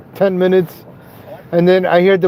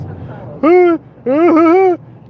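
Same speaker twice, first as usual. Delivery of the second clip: high laugh; 11 semitones higher than usual. Real, not acted. Laughs at own joke.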